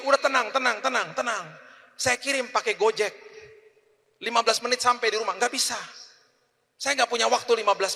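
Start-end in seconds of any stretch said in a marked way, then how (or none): 0.0-5.4 higher voice of wife soothing him; lightly voiced wife saying calm down. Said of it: "Jadi dia bilang begini". (istri menenangkan dengan suara agak tinggi)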